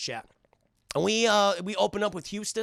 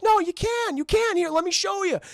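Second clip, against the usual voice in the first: much higher pitched eager character voice; high cartoon voice; 12 semitones higher, 4 dB louder than usual. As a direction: squeak it out like a cartoon helper. Voicing a character encouraging Shadow.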